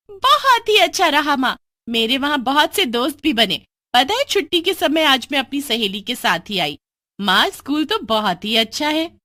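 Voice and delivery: high, loud sweet innocence; hiding her crime with cheer; cheerful girl. Acted. Daughter telling mother about school, high falsely sweet voice.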